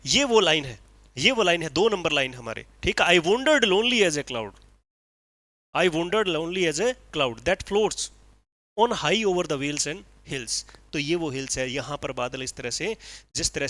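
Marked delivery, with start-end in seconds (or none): 3.1-10.7 slow, measured reading of verse; slow poem recitation. Acted. Teacher reciting Wordsworth to his class, slow and measured. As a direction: savour each line of the poem slowly.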